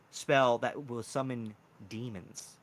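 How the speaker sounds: ordinary talk, nothing marked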